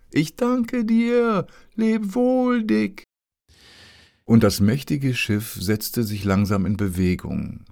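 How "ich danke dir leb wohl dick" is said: much higher, louder, slow call. A narrator's reading. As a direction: stretch the thanks across the water. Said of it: "rief er, so laut er konnte". (langsam, laut hinübergerufen)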